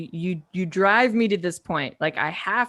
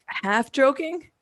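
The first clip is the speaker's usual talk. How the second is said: normal talking voice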